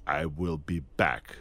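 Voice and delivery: low, slow machine-like "I will be back"; machine reading. Retold or imitated. Actor pitching his version to the director, low and robotic. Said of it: "Why don't we say".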